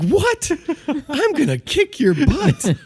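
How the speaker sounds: very high, slow, mock-outraged squawk; comically indignant, drawn out; incredulous outrage. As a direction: act out cocky disbelief. Acted outraged reply to rival.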